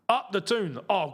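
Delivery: ordinary talk, nothing marked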